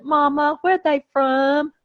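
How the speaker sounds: a hint of a curious little child asking; six-year-old Southern child. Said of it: "when I was six years old".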